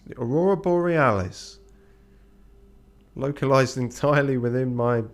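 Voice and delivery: lightly acted comic astonishment; mock disbelief. Quotes a famous comedy scene.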